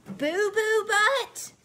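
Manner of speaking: high, slow disbelief on Boo Boo Butt; mock outrage; incredulous. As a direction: drag it out, appalled. Reader incredulous at silly name.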